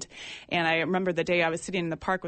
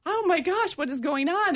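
amazed; 10 semitones higher, 3 dB louder than usual. Retold or imitated. Amazed inner thought.

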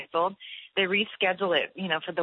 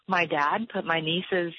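usual speaking voice

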